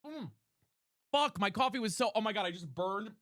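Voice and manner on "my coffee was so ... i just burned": ordinary delivery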